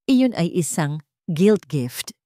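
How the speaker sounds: plain reading voice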